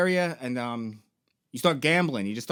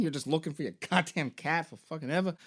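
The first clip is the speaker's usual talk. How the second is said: ordinary talk, nothing marked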